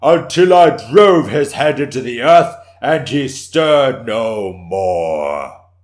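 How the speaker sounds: a warrior acting out his victory, shouting it triumphantly. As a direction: roar the finishing blow.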